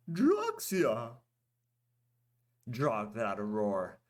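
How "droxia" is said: high blurted exclamation; Goldak voice. A narrator's reading. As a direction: blurt it out in the merchant voice.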